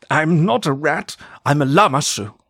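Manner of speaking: lightly voiced offended magical being; indignant enchanted statue. A narrator's reading. Statue speaks, correcting the narrator.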